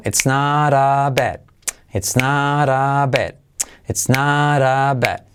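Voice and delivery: "it's not a bad" stretched out in even rhythm; slow rhythmic drill. Shown as a demonstration. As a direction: chant "ets naaraa bad" slowly, evenly. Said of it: "con ritmo". ("it's not a bad" con ritmo, muy lento)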